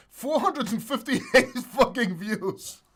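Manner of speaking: laughing. Real, not acted. Mock outrage at low views.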